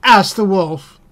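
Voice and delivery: ordinary delivery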